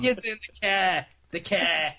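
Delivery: mock Maine accent, dropped r in car; imitating a New England accent. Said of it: "They try to do a Maine accent".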